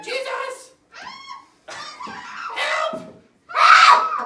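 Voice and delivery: high, loud mock cry for help; desperate cry. Shown as a demonstration. Teacher modelling Peter's big line for Sarah, loud panicky cry. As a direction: shout for help like you're sinking. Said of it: "This is your big line".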